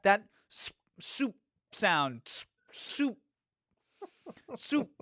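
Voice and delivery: high sucked-in "soup" standing in for a slurp; failed slurp. Acted. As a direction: try to slurp but say "soup". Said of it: "they couldn't make that sound".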